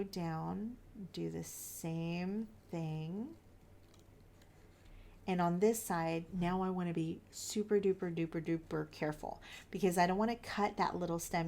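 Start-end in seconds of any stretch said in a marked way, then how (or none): none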